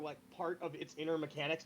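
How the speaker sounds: ordinary talk, nothing marked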